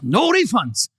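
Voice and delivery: clipped, firm father-in-law voice; Korean accent. Retold or imitated. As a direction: imitate a stern Korean dad. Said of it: "He says it just like that".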